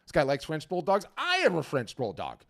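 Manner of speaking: high, loud sudden buyer realization; lightly voiced art buyer lighting up; excited buyer. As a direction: play the buyer who just spotted a kindred spirit. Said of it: "and he's like".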